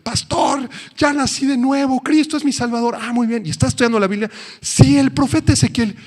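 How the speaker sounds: high, loud youthful voice; young convert telling the pastor he's reading Ezekiel; eager young convert. Retold or imitated. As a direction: sound like an excited new believer.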